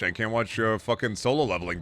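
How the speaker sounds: ordinary talk, nothing marked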